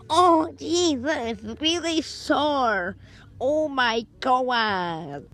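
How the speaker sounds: hammed-up whiny wailing, drawn out; mock pain.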